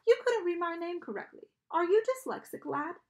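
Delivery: high, loud, theatrical British-sounding ferryman; strange British accent. A narrator's reading. Charon questions the newly dead children, strange British accent. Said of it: "He had a strange accent".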